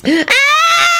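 very high-pitched terrified scream; short piercing scream; hysterical scream. A narrator's reading. Shalonda seeing the bodies, hysterical scream. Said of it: "Shalonda screamed hysterically".

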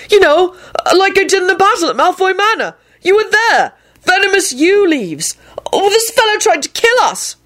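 very high, flustered explanation; frantic. A narrator's reading. Neville justifying himself to the boss, frantic. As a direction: squeak out excuses in a panic. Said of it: "Neville hollered back frantically".

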